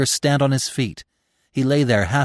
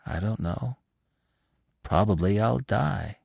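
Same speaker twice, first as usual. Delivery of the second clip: quiet, unhurried acceptance; simple, resigned; 4 dB quieter than usual. A narrator's reading. Dying Gobo answers Bambi's question, simply. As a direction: say it simply, without drama. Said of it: "said Gobo simply".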